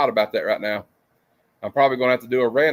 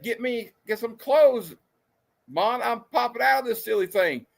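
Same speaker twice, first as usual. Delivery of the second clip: mock character voice; 10 semitones higher than usual. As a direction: ham up the stranded hero. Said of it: "I said".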